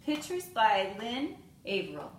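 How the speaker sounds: usual speaking voice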